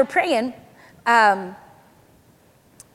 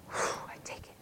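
whew, then a breath of a whisper; whispered receiving; 18 dB quieter than usual. Shown as a demonstration. Preacher showing the congregation how to receive, whispered. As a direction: whisper it on an exhale.

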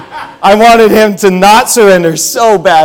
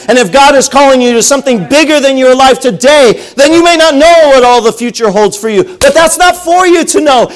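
raised, insistent preaching voice; 7 semitones higher than usual. Acted. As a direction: preach it to the congregation with fervour. Preacher exhorting congregation.

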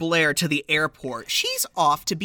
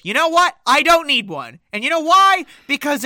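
higher and louder, a hammed-up indignant dad; mock defiant Luther; 6 semitones higher, 7 dB louder than usual. Retelling the movie, Luther snapping at Santa in a mock huff. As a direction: puff up and tell Santa off, half in jest. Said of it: "And he goes".

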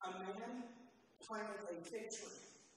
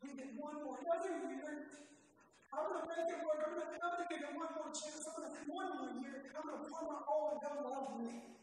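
higher, louder begging for one more year; pleading gardener; 7 semitones higher, 5 dB louder than usual. Acted. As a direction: beg the owner for another chance.

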